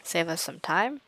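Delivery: ordinary delivery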